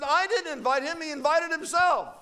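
high-pitched indignant denial; indignant protest. Acted. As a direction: protest it hotly to your wife. Acted indignant husband protests.

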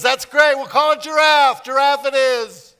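God happily approves the name; approving God. Retold or imitated. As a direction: declare it like a proud father. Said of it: "God says".

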